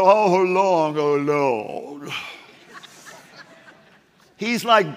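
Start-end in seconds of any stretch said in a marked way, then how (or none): 0.0-2.1 pious prayer. Retold or imitated. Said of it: "You don't think he was like".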